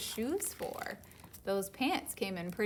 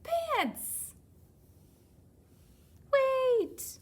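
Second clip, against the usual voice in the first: much higher, louder shout; excited call; 10 dB louder than usual. Acted. Panda spots pants, much higher excited shout. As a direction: call out with surprise.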